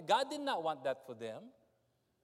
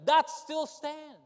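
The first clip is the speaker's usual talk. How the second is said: loud, high preacher's cry; impassioned sermon delivery; preaching at full force; 9 semitones higher, 11 dB louder than usual. Acted. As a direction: preach it out with fire.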